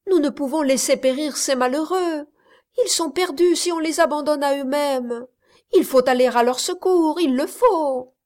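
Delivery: urgent plea. A narrator's reading. Miss Campbell begs the captain to save the men, urgently. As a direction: push the captain to act. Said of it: "s'écria Miss Campbell".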